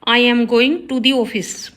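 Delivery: slow, careful read of the corrected sentence; slow teacher dictation. Shown as a demonstration. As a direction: lay out each word clearly for the class. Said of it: "right होगा".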